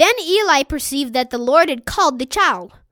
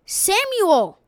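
mildly raised, higher-pitched call; 5 semitones higher than usual. A narrator's reading. Holy voice calls the sleeping boy Samuel, calling out. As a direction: call the name out like a narrated summons. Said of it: "he heard a voice cry".

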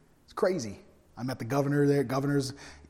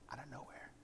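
hushed suspense whisper; whispered build-up to a surprise; 25 dB quieter than usual. Acted. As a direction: drop to a secret whisper before the blast. Building suspense before Boom.

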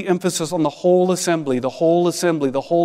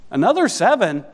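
incredulous, higher and louder; mock disbelief; 5 semitones higher, 3 dB louder than usual. Preacher echoing the verse in disbelief, mock incredulous. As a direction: echo the verse as if you can't believe it.

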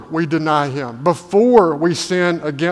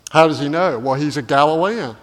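ordinary talk, nothing marked

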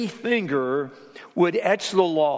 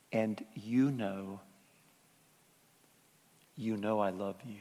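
hushed, unhurried loving aside; tender reassurance; 6 semitones lower, 10 dB quieter than usual. Retold or imitated. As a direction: gently reassure them, leaving pauses. Retold loving reassurance after hard counsel.

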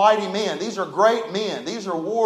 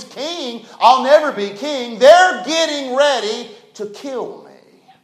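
despairing David; 4 semitones higher, 6 dB louder, slower than usual. Pretend.

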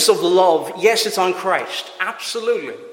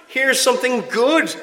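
bright, much higher delighted parent voice; 7 semitones higher than usual. Pretend. Parent to child asking for fruit, high delighted gush.

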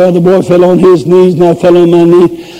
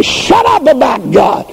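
pitching a fit; 8 semitones higher than usual. Acted. A sinner yelling at churchgoers to stop preaching, furious. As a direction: rant like a fed-up sinner sick of hearing about God. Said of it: "You pitch a fit and say".

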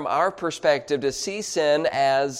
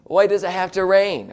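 louder, higher-pitched mock whine; complaining; 5 semitones higher, 6 dB louder than usual. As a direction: gripe about the weather, hammed-up.

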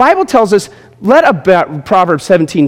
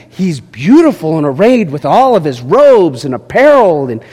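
ordinary talk, nothing marked

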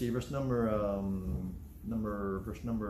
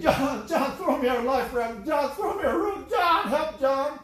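much higher, louder, desperate calling; panicked cry for help; 12 semitones higher, 6 dB louder, slower than usual. Retold or imitated. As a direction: yell for help as if drowning. Drowning Peter calling to John.

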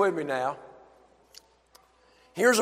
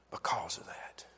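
whisper; 12 semitones lower, 16 dB quieter than usual. Real, not acted. Hushed aside in sermon.

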